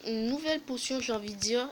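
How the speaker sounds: ordinary delivery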